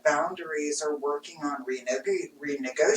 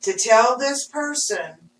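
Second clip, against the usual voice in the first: emphatic retort; 6 semitones higher, 8 dB louder than usual. Retold or imitated. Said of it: "And I'm like".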